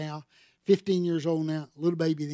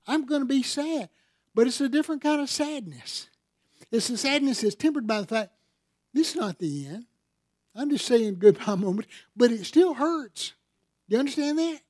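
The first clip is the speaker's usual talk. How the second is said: impassioned preaching; 8 semitones higher, 5 dB louder than usual. Real, not acted. Preacher moved about grieving.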